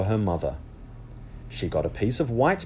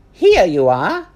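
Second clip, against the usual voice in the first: higher, louder friendly server; lunch lady voice; 9 semitones higher, 10 dB louder, slower than usual. Acted. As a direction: offer food over the counter.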